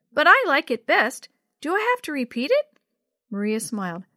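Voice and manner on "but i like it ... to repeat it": put-on high, loud little-girl voice; complaining little sister. A narrator's reading. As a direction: plead like a small child avoiding a chore. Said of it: "Yo-Yo complained".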